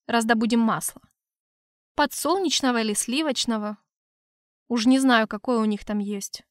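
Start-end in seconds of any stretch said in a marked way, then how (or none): none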